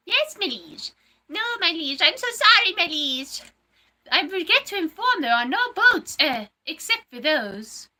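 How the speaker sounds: high-pitched, pleading servant voice; movie-quote character. Acted. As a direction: report to your master in a high, theatrical voice. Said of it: "I do quotes from movies".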